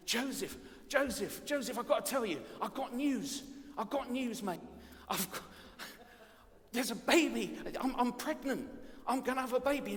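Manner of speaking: high, urgent London soap voice; hammed-up EastEnders-style Londoner breaking big news; London accent. Mary tells Joseph she's pregnant, melodramatic London accent. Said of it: "I can do the London accent now".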